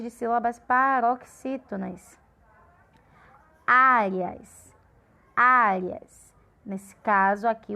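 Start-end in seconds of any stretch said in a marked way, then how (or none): 3.7-6.3 "Áreas" with a long, forceful first "Á"; stress on A. Shown as a demonstration. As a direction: hit the opening vowel of "Áreas" hard and hold it. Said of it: "A sílaba de maior força é o A".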